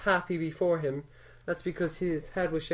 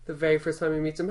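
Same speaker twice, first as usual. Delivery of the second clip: ordinary delivery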